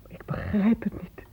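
breathy whisper, barely voiced. Mother whispering to the doctor in disbelief. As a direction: say it under your breath in shock.

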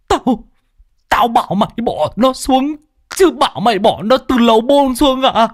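put-on high exasperated old voice; old man scolding. Old teacher scolds the boy in exasperation.

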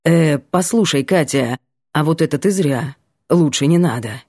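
a hint of a guy cautioning her; Vanya's voice. A narrator's reading. Male character voice, lower.

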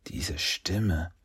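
hushed, whispered suspicion; murmured. A narrator's reading. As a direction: murmur it almost without voice. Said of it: "murmelte Leo". (leise gemurmelt)

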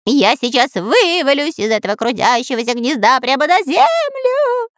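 high cartoon whine rising to a squeal; whining. Spy afraid to fall from his nest, high whine. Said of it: "продолжал скулить Дырка".

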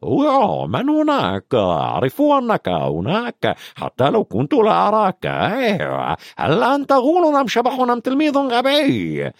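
high, sly poltergeist voice; taunting mischievous ghost, sing-song; Peeves. A narrator's reading. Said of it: "عيناه السوداوان الخبيثتان". (صوت بيف الخبيث العالي)

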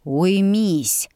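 growling bugbear. A narrator's reading. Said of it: "рыкнул в ответ генерал".

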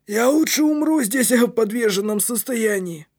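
put-on high, vehement boy's voice; exclaiming. A narrator's reading. Кнут vows never to go back down, exclaiming. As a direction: swear it emphatically, pitched high. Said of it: "воскликнул Кнут".

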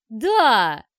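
loud, long-drawn gleeful shout; enthusiastic yell. A narrator's reading. Fathers gleefully back the child's question, yelling. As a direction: bellow it with obnoxious enthusiasm. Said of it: "С отвратительным энтузиазмом заорали папашки".

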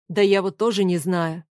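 usual speaking voice

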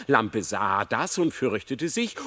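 usual speaking voice

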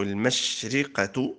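المشرقة said slowly with "i" after the ra, mashriqatu; kasra on the ra. Shown as a demonstration. Teacher showing the second word's forms, kasra on the ra of المشرقة. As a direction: sound المشرقة as mash-RI-qa. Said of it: "والكسر والضم".

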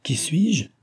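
confused and frightened. A narrator's reading. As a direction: ask in startled disbelief. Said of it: "confus et effrayé".

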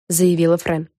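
ordinary delivery, nothing marked

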